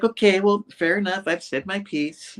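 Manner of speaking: high, prim final word; mock satisfied. Gwen ending the dinner argument, high prim mock satisfaction. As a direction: sign off like you won. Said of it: "And she's like".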